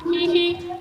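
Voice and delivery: high sing-song giggles; giggling. Acted. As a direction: giggle like a sneaky hider. Eggs giggling while hiding.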